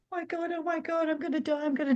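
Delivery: high, hushed mock panic at the chairlift; retold panic. Skier before the chairlift, reliving her panic in a mock-terrified voice. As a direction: replay the terror of heights, half-played. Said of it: "I was terrified of heights".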